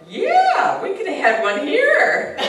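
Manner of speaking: very high, loud laughing quip. Retold or imitated. Joking to the officer, laughing loudly. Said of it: "I said".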